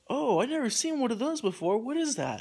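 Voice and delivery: high, swooping mock wonder; imitated amazement. Stranger seeing an iPhone, imitated high amazement.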